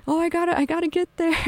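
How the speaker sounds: high, put-on artist voice; mock session musician. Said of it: "like all the session artists".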